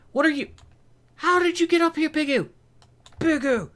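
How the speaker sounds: very high, loud squawk of surprise; startled shouting. Real, not acted. Player finding a pig up here, startled high shouting. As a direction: yelp it at an unexpected intruder.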